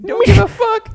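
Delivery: loud, very high shout; voiced heedless dog. Friend voicing dog among sharks, loud carefree shout.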